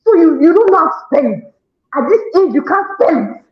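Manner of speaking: high-pitched, exasperated mock yelling; imitated yelling. Mother re-enacting homework with her child, yelling in exasperation. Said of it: "I'll be yelling".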